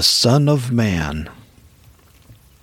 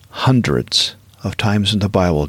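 ordinary delivery

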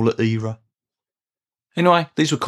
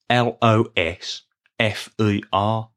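slow letter-by-letter spelling; measured spelling with gaps between letters; slower than usual. Shown as a demonstration. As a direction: spell it slowly, one letter at a time. Spelling out the made-up word.

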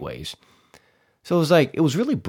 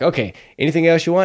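quick, lightly pitched-up order taker; playful drive-thru clerk; 6 semitones higher, 5 dB louder, faster than usual. Retold or imitated. Drive-thru guy asks customer for more, quick and playful. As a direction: rattle it off, a bit higher and louder. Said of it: "just horsing around so much".

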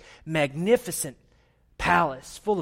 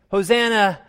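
mock crowd shout, raised pitch; retold shout; 4 semitones higher, 6 dB louder than usual. Crowd greeting the Son of David, half-shouted acclamation. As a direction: call it out louder and higher, short of yelling. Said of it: "they shouted something like this".

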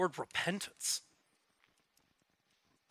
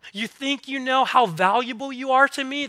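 high, louder mock-incredulous question; playful teasing; 7 semitones higher, 5 dB louder than usual. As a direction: tease them as if they have no idea. God playfully teasing believers.

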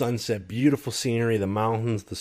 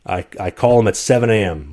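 usual speaking voice

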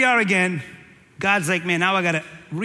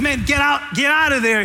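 loud, higher urgent command; God calling out; 4 semitones higher, 4 dB louder than usual. Acted. God shouting at Abraham to get out of there. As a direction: push him out the door.

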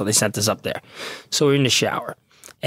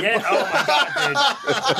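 high, breaking into laughter; words spilling out through real laughter; laughing hard; 9 semitones higher, slower than usual. Real, not acted. Cop laughing at his buddy's Viagra joke, laughing.

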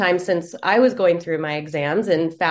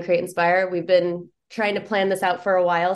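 ordinary talk

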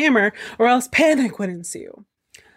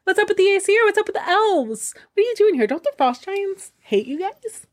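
much higher, chatty ogre voice; chummy ogre; 11 semitones higher than usual. Acted. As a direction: greet him like an old buddy, pitched way up. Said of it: "and the ogre says".

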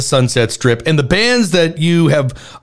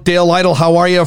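ordinary talk, nothing marked

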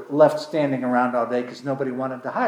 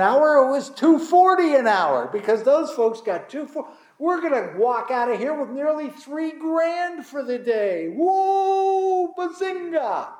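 excited; 9 semitones higher than usual. Retold or imitated. Preacher voicing early workers dreaming of three grand, excited. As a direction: ham up the greedy glee.

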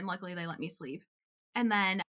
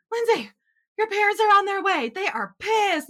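high, loud alarmed warning; urgent warning; 10 semitones higher, 10 dB louder than usual. Retold or imitated. Friend's mom warning Lindsay, loud and high alarmed. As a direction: sound the alarm, parents are coming. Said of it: "my friend's mom is like".

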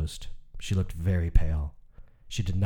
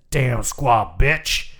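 slow, drawn-out angry curse, a bit louder; pained curse; 4 semitones higher, 4 dB louder, much slower than usual. Acted. Uncle George, bitten on the arm, curses the woman slowly and angrily. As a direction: spit the curse out slowly after the bite.

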